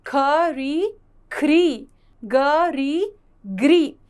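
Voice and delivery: slow "ख", "री", then joined "ख्री"; syllable blending demo. Shown as a demonstration. Teacher repeating how री is pronounced with consonants, blending demo. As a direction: say "ग री" in parts, then "ग्री" as one. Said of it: "इसका उच्चारण होता है री".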